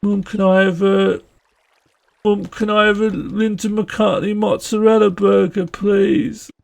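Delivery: put-on high Brummie child's request; Brummie accent. Child asking mum for a burger, high Brummie accent. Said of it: "doing a Brummie accent asking for".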